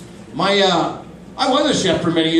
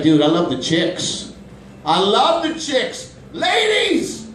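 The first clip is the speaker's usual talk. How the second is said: slow, then soaring high "Ladies!"; hammed-up ladies' man; bro voice; 6 semitones higher, slower than usual. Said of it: "a man who's like".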